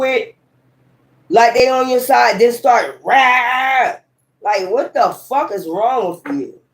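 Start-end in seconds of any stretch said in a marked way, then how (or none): 3.0-4.0 a sudden, higher yelled rah; imitating a hostile outburst; mock snake lash-out yell. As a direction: snap out a sharp rah like a striking snake.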